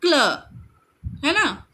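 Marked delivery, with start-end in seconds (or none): 0.0-0.1 क्ल with क stronger than ल; stress on first consonant. Shown as a demonstration. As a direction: lean on क and let ल be lighter in क्ल. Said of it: "क को हम लोग स्ट्रेस दे रहे हैं".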